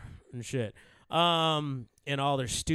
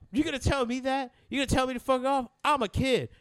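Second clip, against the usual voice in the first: put-on higher, fast indignant kid; 8 semitones higher than usual. Teller imitating the kid protesting at him, higher and fast. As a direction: talk back like an outraged kid.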